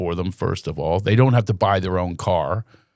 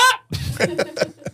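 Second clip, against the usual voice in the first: high breathy giggle; 4 dB quieter than usual. Acted. After the impression.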